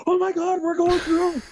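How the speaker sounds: very high, frantic pretend scream; mock panic scream. Prankster waking Rhino with ice water, mock panic scream. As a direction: fake terror to prank a sleeper. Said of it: "hurl it on him and go".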